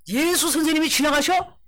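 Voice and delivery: high, surprised rising question; amazed question. Acted. Beggar hearing that Jesus is passing, amazed. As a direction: ask it like startling good news.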